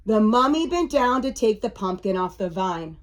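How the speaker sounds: usual speaking voice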